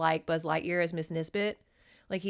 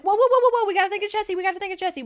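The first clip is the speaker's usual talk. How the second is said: high, raised-voice frantic stopping; urgent pleading; 13 semitones higher, 9 dB louder, much faster than usual. Retold or imitated. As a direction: talk someone down in an acted panic. Acting man stopping friend breaking door.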